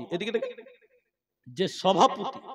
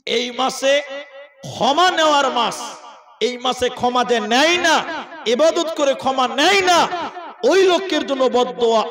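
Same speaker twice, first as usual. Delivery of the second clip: preaching; 2 semitones higher, 4 dB louder than usual. Acted. Rising sermon exhortation.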